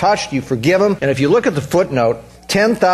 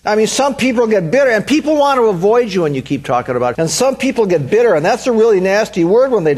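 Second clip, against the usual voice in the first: full-force preaching; 7 semitones higher than usual. Acted.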